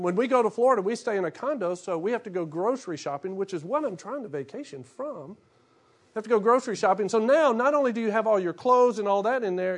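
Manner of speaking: ordinary delivery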